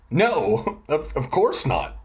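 ordinary delivery

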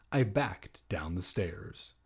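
ordinary talk, nothing marked

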